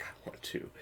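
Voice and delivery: hushed, voiceless longing; whispered reply. Acted. Tempted reader answering the books calling her, whispering.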